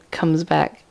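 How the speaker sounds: ordinary talk, nothing marked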